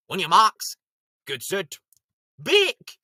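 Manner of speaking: announcer calling go; starting call. Acted. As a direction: call the start like a race official.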